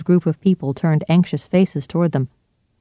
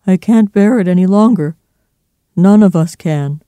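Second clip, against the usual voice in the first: loud, higher-pitched desperation; voice rising; 4 semitones higher, 8 dB louder than usual. A narrator's reading. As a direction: raise your voice as if at breaking point. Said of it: "His voice rose".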